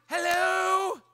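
high loud sarcastic Hello; shouted hello. Retold or imitated. Preacher at a red light calling out to silent drivers, shouted hello. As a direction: call out to the silent cars.

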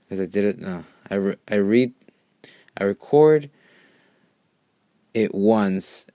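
ordinary delivery

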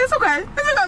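high-pitched imitation of a tense "it's okay"; tight reassurance. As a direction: mimic someone upset insisting it's fine. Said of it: "I knew he was tight".

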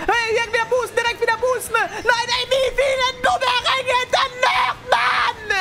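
very high-pitched rage screaming; frantic shouting. Real, not acted. Streamer hit by boomerangs near the finish, enraged screaming. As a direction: scream high, building from panic into fury.

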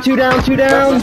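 combat callout. Real, not acted. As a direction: shout the kill count up high. Teammate calling kills mid-fight.

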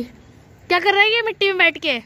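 high, loud scolding; scolding call. Real, not acted. Aunt scolding Keshu for sitting in dirt, loud and high.

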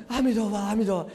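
quick, panicky recitation of the Buddha's name; mock fright. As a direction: rattle it off as if scared. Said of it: "吓坏了". (假装吓坏了，急急地念佛)

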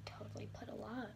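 whispered under her breath; quick whispered aside. Real, not acted. Quiet aside while doing makeup.

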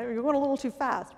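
quoting the patient. Retold or imitated. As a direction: say it as the patient's quick reply. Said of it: "patient's answer quoted".

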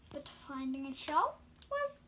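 ordinary delivery

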